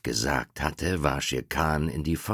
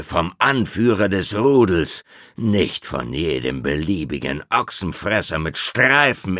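proud wolf voice; 4 semitones higher, 7 dB louder than usual. Acted. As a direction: stand firm against the tiger. Father wolf defies the tiger. (stolzer, trotziger Wolf)

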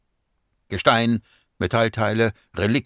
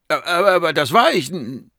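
high, loud stammering denial; stuttering, alarmed self-defence; flustered protest; 9 semitones higher, 6 dB louder than usual. Acted. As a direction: stammer in panic until cut off. Garet protests, cut off by noise.

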